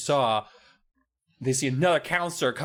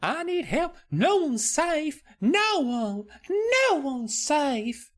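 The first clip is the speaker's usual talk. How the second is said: put-on much higher, slow, pleading child's voice; frightened little girl; slower than usual. Alicia begging Wendy for help, high frightened child voice. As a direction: beg for help like a terrified kid. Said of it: "and she's like".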